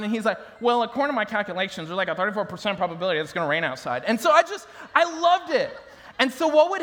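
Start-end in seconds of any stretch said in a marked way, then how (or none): 0.6-4.0 rapid-fire nerd reciting calculations; nerdy smart one. Acted. Boy playing the brainy Power Ranger, nerdy. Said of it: "like, the nerdy, smart one".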